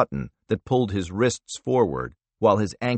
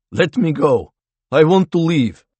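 higher, louder panicked plea; voice cracking; 7 semitones higher, 8 dB louder than usual. Acted. Prisoner begging the interrogator to release him, cracking with fear. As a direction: beg to be let go, voice breaking with fear. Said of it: "His voice cracked".

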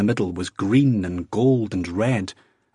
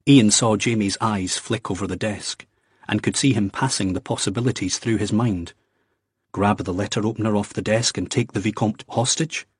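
plain reading voice

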